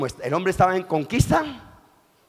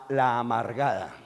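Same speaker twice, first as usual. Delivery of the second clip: ordinary talk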